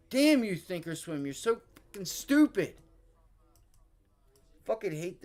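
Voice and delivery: venting real rage at the software; furious rant. Real, not acted. Streamer yelling at the Thinkorswim charts, furious and high.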